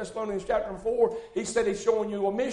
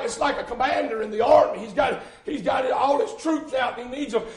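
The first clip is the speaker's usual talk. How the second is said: ordinary delivery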